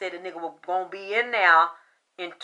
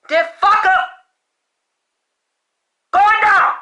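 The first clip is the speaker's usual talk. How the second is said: loud, hammering shouts at the doubters; furious rant; 6 semitones higher, 12 dB louder than usual. Real, not acted. Psychic ranting at critics who called her a liar, shouting. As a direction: yell it like nobody believes you.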